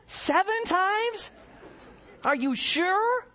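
slow, high-pitched disbelief; incredulous questioning. Acted. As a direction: stretch each question out, voice way up. Peter's disbelief at forgiving seven times.

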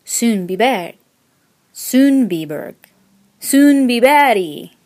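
"Sundbyberg" slow and loud, stress moved around, rounded vowels; experimenting with pronunciation. A narrator's reading. Newcomer trying "Sundbyberg" repeatedly to be understood, exaggerated rounded vowels. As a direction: keep retrying "Sundbyberg", stretching it further each time. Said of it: "stressing different syllables and rounding my vowels".

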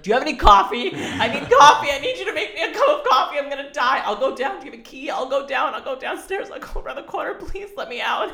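high, loud, laughing plea for coffee; frantic coffee plea. Retold or imitated. Pleading with her ex for coffee, high and frantic. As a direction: beg in a rising, giddy panic. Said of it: "And I was like".

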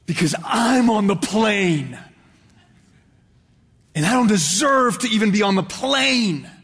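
hammed-up joy at being aboard; acted grateful exclamation. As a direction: exclaim it like an overwhelmed, grateful passenger. Imagined grateful passenger exclaiming joyfully.

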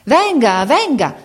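high, loud eager calling; chorused invitation. Acted. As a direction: call her over all together. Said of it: "fecero tutte in coro". (invito in coro, acuto e forte)

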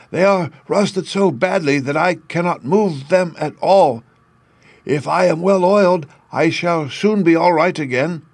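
high-pitched, plaintive tin-man voice; sad Tin Man. A narrator's reading. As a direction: voice a rusted man pleading for help. Tin Man figure in story.

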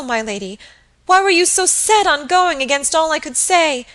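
high, loud, agitated voice; anguished pleading. A narrator's reading. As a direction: beg desperately to be told you're wrong. Kneeling maid accuses and begs.

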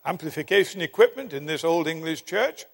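ordinary talk

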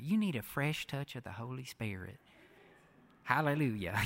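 soft southern evangelist accent, imitated. Bishop praying over the speaker at a conference, imitated southern accent. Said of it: "he said it in this southern accent".

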